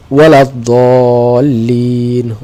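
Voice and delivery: ওয়ালাদ-দাল্লিন with very long, level held vowels; slow recitation. Shown as a demonstration. Teacher reads the verse to find lam, reciting slowly.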